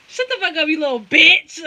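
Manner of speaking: high start, loud punch on "bitch"; mock outburst. Snapping back at the innocent act, loud mock outburst. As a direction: explode in a play-insulting comeback.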